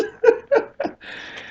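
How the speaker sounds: high airless laugh; breathless wheeze laugh. Real, not acted. Telling the story, he wheezes with laughter.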